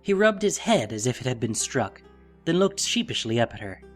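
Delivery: ordinary delivery, nothing marked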